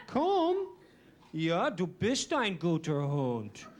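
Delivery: mock dog-owner talk in German; speaking German to dogs. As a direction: coax a dog in careful German. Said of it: "like speaking German to dogs".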